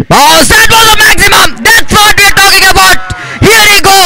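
shouting high over a huge six; excited cricket commentary. Real, not acted. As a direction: erupt with joy at the six. Six hit, commentator erupts.

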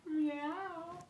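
soft high meow; cat meow. Pretend. Voicing Oscar the cat.

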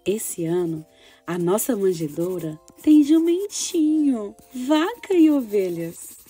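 put-on gentle storytelling for children; story narration. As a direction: read it like a child's diary. Reads girl's diary story.

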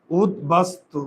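উদ, বাস, তু in pieces, বাস ending in s; উদ, বাস, তু carefully separated by syllable; dental s. Shown as a demonstration. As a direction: sound out উদ, বাস, তু with s, never sh. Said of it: "দন্তস্ব তালব্য স হয় নাই".

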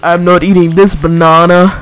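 movie quote. Acted. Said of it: "sounds like a quote from a movie".

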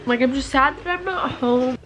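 teary, half laughing, pitch up; crying through laughter. Real, not acted. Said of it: "But I'm crying".

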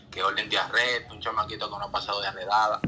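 normal talking voice

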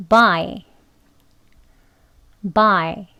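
buy said slowly, clearly; word drill. Shown as a demonstration. Teacher models buy twice for learners, careful drill. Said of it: "buy, 사다라는 뜻의 단어 발음입니다".

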